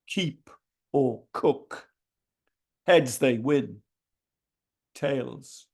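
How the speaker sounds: deliberate verse reading in short, separated phrases; measured poetry performance; poem recital. Acted. Reads own poem aloud.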